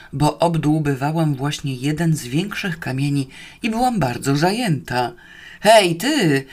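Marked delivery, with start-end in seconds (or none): 5.6-6.4 raised, higher, anxious call; worried yelling. A narrator's reading. Watchman shouting down the hole to the prisoner, worried yell. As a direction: call out loudly for someone out of sight. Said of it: "darł się zaniepokojony cieć".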